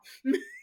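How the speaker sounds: very high giggle; high, knowing chuckle; laugh. Real, not acted. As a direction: squeal into a giggle. Laughs at her innuendo.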